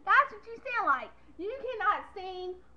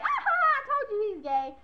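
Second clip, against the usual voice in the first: gloating grandma; 5 semitones higher, 4 dB louder, much faster than usual. Retold or imitated.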